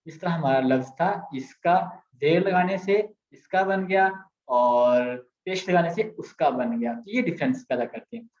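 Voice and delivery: ordinary talk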